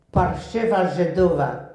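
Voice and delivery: loud, sneering taunt in Polish; slur. Retold or imitated. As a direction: spit the insult at her face. Boy taunting her at recess.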